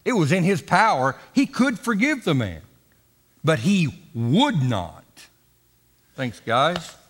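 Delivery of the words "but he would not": "would" slowed and stressed. Shown as a demonstration. Preacher contrasting could and would, stressing "would". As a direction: press on "would" slowly. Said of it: "It didn't say he could not".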